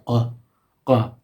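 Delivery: "uh" with a French R at the back of the throat; French R sound. Shown as a demonstration. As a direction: demonstrate "uh" as a short French R sound. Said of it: "the French R sound".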